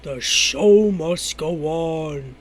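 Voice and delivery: slow belched words; burping the words. Acted. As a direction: drag the words out on a burp. Burp contest, talking while burping.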